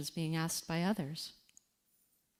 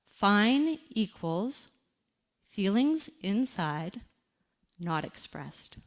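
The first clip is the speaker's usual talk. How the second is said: slow, deliberate definition; slower than usual. Shown as a demonstration. Grief coach slowly spelling out what fine really means.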